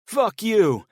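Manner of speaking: furious yell at full force; shouting. Acted. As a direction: scream it in his face. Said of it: "Ed shouted".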